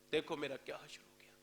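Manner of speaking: broken whisper; whispered lament. Retold or imitated. Ruined son pleading to the crowd, broken whisper. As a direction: whisper the ruin out.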